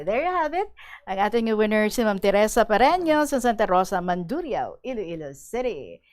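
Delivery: loud, bright on-air announcement; booming radio host voice; radio announcer. Acted. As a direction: announce the winner to the listeners. Radio host announces contest winner.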